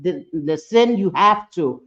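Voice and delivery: ordinary talk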